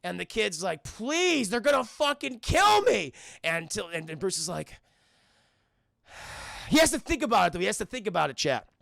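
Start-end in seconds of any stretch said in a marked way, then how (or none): 0.8-3.1 high, loud begging; mock desperate scream. Streamer voicing Terry begging Bruce to save him, screaming. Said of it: "hearing Terry scream". 6.1-6.6 soft, breathy acted sigh. Streamer voicing Bruce thinking it over, sighing. Bruce weighing whether to help.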